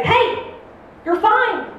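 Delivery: reassuring. Retold or imitated. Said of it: "and I say".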